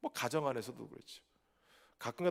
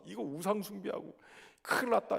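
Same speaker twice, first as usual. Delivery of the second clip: higher, rising alarm, "we're in trouble"; mild mock alarm; 6 semitones higher than usual. Pastor voicing Israelites alarmed at the altar, mock panic. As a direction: sound the alarm, half-acted.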